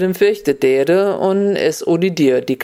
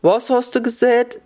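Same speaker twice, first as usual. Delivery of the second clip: high-pitched "what did you say?" query; puzzled asking back; 8 semitones higher than usual. A narrator's reading. Old woman who hears badly asks the caller back, high puzzled query. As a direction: ask back as if you missed it.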